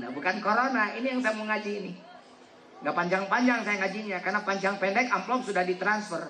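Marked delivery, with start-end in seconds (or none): none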